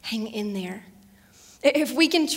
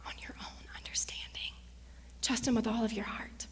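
soft hushed scripture; whisper; 8 dB quieter than usual. Real, not acted. Pastor whispering a promise to the listeners. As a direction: breathe the verse to them.

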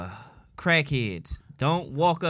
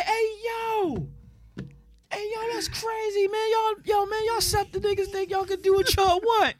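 much higher, outraged street voice; mocking crowd voice. Bystanders around the truck scolding him, high-pitched and outraged. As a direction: mimic angry bystanders ganging up.

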